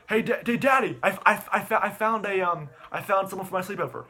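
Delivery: put-on high, loud kid voice, stumbling; excited child. Son telling Daddy he found someone, high and excited. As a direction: imitate a boy bursting with news for Dad. Acts boy telling dad news.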